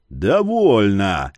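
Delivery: slow, low, booming order; booming command. Acted. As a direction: thunder it out to stop the fight. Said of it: "прогремел голос".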